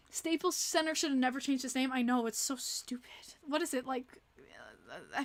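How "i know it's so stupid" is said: slow unvoiced whisper. Real, not acted. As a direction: breathe it out, barely voiced. Agreeing with chat in whisper.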